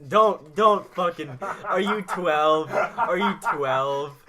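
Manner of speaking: loud, slow, incredulous yell; loud mock-indignant are you twelve; mock outrage. Real, not acted. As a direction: bellow it at him in disbelief. Mocking friend's joke loudly.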